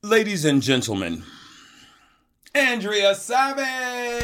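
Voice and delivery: slow, high showman introduction, drawing out the name; grand announcement. Acted. Host to audience, announcing the guest with a slow, high flourish. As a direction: present the guest like a game show host.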